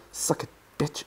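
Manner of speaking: breathy whisper taunt. Real, not acted. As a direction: hiss it under your breath.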